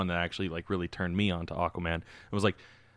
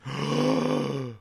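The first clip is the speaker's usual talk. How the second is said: mock gasp; 6 semitones higher than usual. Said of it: "and they're like".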